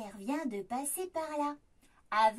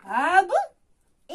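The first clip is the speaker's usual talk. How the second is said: usual speaking voice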